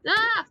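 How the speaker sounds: short, high startled cry; startled yelp. Real, not acted. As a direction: yelp in sudden fright. Startled while playing the game.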